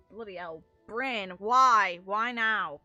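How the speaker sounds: ordinary talk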